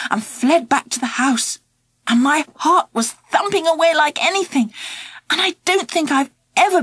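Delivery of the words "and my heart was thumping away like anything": ordinary delivery, nothing marked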